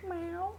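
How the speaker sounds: soft, high little meow; imitated meow. Streamer trying to get the cat to answer, soft high meow. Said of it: "meow back at me".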